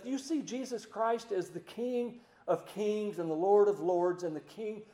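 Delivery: usual speaking voice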